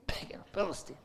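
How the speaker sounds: breathy whisper of the phrase, with a laugh; whispered repeat. Acted. Preacher savouring the phrase, whispering it with a laugh. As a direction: whisper it back to yourself, amused. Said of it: "repeats in whisper".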